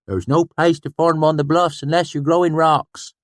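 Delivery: high, loud retort; hot-tempered swineherd. A narrator's reading. Sheem retorting hotly, high and loud. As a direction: snap back scornfully. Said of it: "he said hotly".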